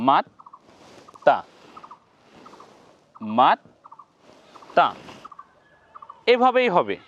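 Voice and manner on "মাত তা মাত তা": মাত and তা said apart with long pauses, twice; teacher drills the syllables for viewers; split syllable demo. Shown as a demonstration. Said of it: "দেখুন উচ্চারণ করব কি?".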